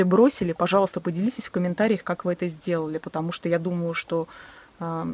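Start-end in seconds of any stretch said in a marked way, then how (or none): none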